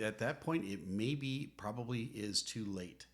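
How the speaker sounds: normal talking voice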